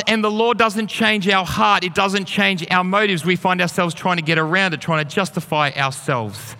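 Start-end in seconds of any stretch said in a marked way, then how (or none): none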